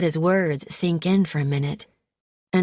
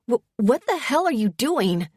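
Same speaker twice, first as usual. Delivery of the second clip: higher, stammered shock; startled yelp; 6 semitones higher than usual. A narrator's reading. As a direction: caught off guard, blurting. She yelps as he grabs her.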